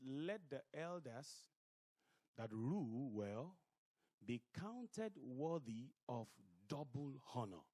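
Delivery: slow, solemn Bible reading; scripture reading. Acted. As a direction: read each phrase with weight. Reads scripture slowly.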